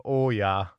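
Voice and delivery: "Oh" stretched into a long rounded Minnesotan O. Shown as a demonstration. Host shows how Minnesotans say oh, with a long Minnesotan O. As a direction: draw out the "Oh, yeah" with a long Minnesotan O. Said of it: "Those long O's catch us".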